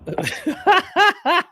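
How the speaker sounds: sudden high burst of laughter; real laughter at the video. Real, not acted. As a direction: crack up, high and loud. Laughing at the clip.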